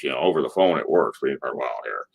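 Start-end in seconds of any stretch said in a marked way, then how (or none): 1.6-2.0 very low, quiet imitation; little guy putting on a deep voice; Bruce's deep voice. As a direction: rumble it softly, down deep. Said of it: "little guy talks with a deep voice".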